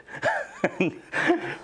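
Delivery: high airy laugh; an amused breathy chuckle. Real, not acted. Interviewer laughing at Harrison's Murdoch question.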